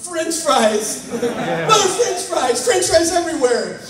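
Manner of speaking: high yelled minced curse; mock yell. Startled man yelling a fake curse at the intruder at his door. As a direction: blurt it out high like a startled yelp. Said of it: "and I yell".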